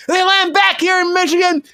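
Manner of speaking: excited riff. Acted. Absurd story keeps escalating.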